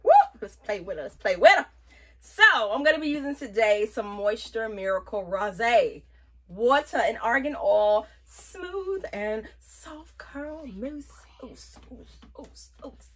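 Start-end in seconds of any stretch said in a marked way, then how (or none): 1.0-1.7 eager to start styling, said louder. Acted. Says it twice, second louder. 8.2-11.1 savouring the product name, slowly; slow and drawn out. Acted. 11.4-13.1 breathy whispered oops; a tiny slip, hushed. Real, not acted. Little slip while applying mousse.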